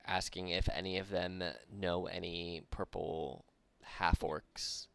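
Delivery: ordinary talk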